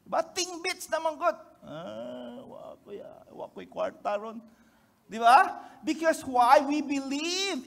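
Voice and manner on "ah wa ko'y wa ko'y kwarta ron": broke sigh. Retold or imitated. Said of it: "your countenance when it's tingbitay".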